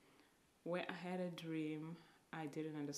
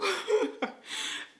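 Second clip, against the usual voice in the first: high, loud sudden laugh; loud embarrassed laugh; burst of laughter; 14 semitones higher, 14 dB louder than usual. Real, not acted. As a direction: laugh out at your own stubborn no. Laughs after refusing the Lord.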